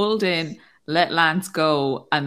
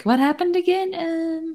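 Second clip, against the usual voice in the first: much higher, puzzled squeak; high confused voice; 13 semitones higher than usual. Retold or imitated. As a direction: sound dazed and lost. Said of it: "I'm just like".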